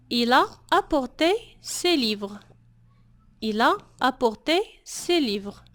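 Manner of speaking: slow, careful French model sentence. Shown as a demonstration. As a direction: say each word slowly for learners. (جملة فرنسية بطيئة وواضحة للمتعلمين)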